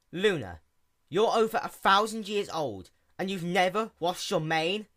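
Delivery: can't believe it; incredulous. A narrator's reading. Sea pony asks in disbelief about the unwashed mane.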